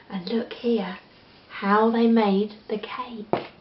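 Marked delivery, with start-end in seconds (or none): 0.1-1.0 unvoiced pointing aside; hushed look here; whisper. Real, not acted. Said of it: "whispered aside to child".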